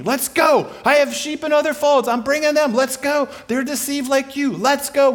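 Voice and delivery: high, loud and urgent; rally the troops to move out; rallying call. Acted. Jesus rallying followers to come.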